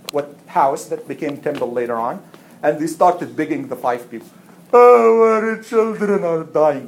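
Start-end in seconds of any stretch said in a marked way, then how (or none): 4.7-6.9 slow, high put-on wail; hammed-up tearful plea; mock wailing. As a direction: plead like a crying parent. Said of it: "with tears".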